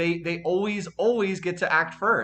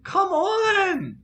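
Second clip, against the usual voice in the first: higher, louder, voice breaking with rage; real gamer fury, cracking at the top; furious ranting at the game; angry, voice cracking; 6 semitones higher, 3 dB louder than usual. Real, not acted. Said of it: "my voice is cracking 'cause I'm so angry".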